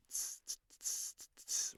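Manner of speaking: voiceless hissed cymbal taps; cymbal imitation. Shown as a demonstration. Teacher showing the sound from his mouth, cymbal imitation. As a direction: tap out a cymbal pattern with "tss". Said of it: "sounds like a cymbal".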